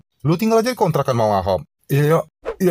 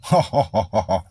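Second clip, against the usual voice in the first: slow, lower belly laugh; mocking laugh; 6 semitones lower, much slower than usual. Shopkeeper teasing Gong about ghosts at noon, mocking laugh.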